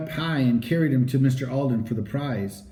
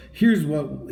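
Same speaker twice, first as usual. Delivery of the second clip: ordinary delivery, nothing marked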